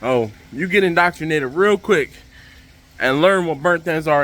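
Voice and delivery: ordinary talk, nothing marked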